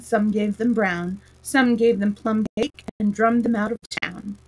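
usual speaking voice

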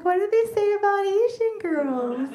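high, slow, sweetly puzzled; mock innocent. Answering the pickup line, innocent mock question. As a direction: stretch it out, playing naive. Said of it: "I'm like".

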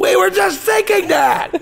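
high, eager sing-along audience; audience member voice. Pretend. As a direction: blurt it like a fan who knew the joke. Audience sings along with punchline.

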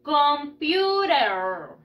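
"Computer" very slow, with a drawn-out final R; R-like sound. Shown as a demonstration. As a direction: stretch "Computer" for repeating, ending on a long R. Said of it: "como si fuera una R". ("Computer" muy lento, como con una R)

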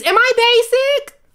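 high, slow squeaky question; mock worried. Asking viewers if she is basic, mock worried. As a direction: ask it in a tiny squeak. Teasing herself.

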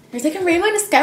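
excited. Real, not acted. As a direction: say it again with real delight. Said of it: "repeated excitedly".

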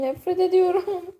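real frustrated whimper; whiny frustrated cry. Real, not acted. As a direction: whine it like you're about to cry. Frustrated at the bug.

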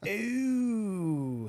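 high, drawn-out sarcastic ooh; Cam's sarcastic voice. Retold or imitated. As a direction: tease with a sneering ooh. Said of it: "Cam has this voice that he does when he wants to be sarcastic".

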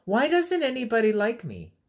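high, loud, plaintive question; sad pleading fish. Acted. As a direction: ask like a lonely little fish begging. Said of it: "he asked".

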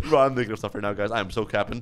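normal talking voice

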